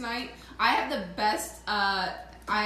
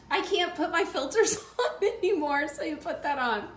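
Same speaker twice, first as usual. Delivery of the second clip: giggling high through the words; laughing; 8 semitones higher, 4 dB louder than usual. Real, not acted. Cohost handing over the hat, laughing hard. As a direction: laugh while you talk.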